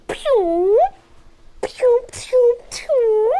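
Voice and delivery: high playful pew-pew noises; pew sound effects. Pretend. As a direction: zap out little high laser sounds. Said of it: "I'm ready to transform".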